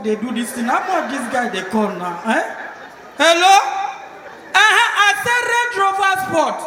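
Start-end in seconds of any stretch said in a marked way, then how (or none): 3.2-6.6 louder, higher, can't believe it; disbelief. Acted. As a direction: ask again, stunned. Shocked caller asking price.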